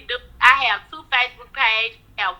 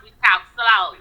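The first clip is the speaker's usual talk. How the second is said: ordinary talk, nothing marked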